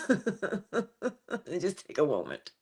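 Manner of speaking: amused, slow self-calming; laughing calm-down. Real, not acted. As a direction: laugh as you settle yourself. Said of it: "getting a little worked up".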